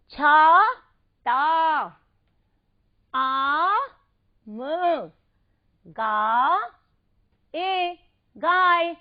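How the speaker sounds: loud, separated syllables for repeating; syllable drill. Shown as a demonstration. Teacher leads kids repeating syllables, loud and separated. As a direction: sound out each syllable alone, with a pause between. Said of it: "आप मेरे साथ साथ इसे बोलिए".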